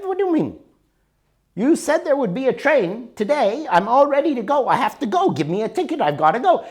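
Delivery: retold frustration, pushing for the ticket; angry. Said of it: "Now I'm getting really angry".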